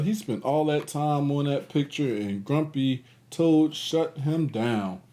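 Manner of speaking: plain reading voice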